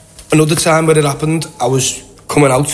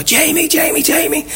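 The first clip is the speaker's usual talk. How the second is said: high-pitched imitation of excited fans; very high, contained fan cry; mock excited calling. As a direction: squeal the name like fans, without real volume. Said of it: "keep your voices down".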